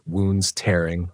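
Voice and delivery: ordinary delivery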